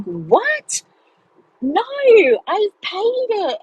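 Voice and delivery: panicked protest. Retold or imitated. Panicked retort to tax office.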